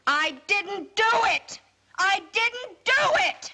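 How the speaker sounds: put-on girl screaming her innocence at her father; hysterical scream. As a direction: scream it over and over.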